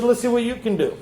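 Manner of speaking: God's voice. Retold or imitated. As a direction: dare him as God would. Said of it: "God talk like that".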